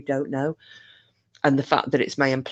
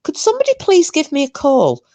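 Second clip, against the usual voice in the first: much higher, pleading, innocent-sounding caller; fake helpless voice; 8 semitones higher, 5 dB louder than usual. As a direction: play dumb and helpless, high and pleading. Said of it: "I just blagged and said".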